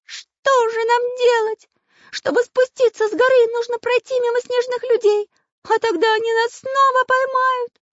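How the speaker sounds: very high, loud character voice; high, loud cartoon voice in despair. A narrator's reading.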